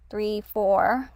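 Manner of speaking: ordinary delivery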